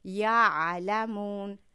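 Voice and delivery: یعلمون stretched very slowly, ع pressed from mid-throat; throat letter demo. Shown as a demonstration. Teacher demonstrating ع for Quran students, slow. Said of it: "از مابین باید خارج شود".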